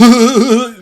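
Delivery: high, short rippling cackle; demonstrated female crazy laugh. Shown as a demonstration. Showing how women laugh when plotting, high mock cackle. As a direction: show off a scheming little cackle. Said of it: "that, that laugh, like, like that".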